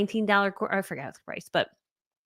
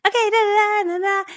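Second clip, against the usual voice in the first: higher bright-toned presenter gloss; sweet presenter voice; 12 semitones higher, 4 dB louder than usual. Retold or imitated. Lady doing a training greets her audience sweetly. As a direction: put on a sugary on-camera voice.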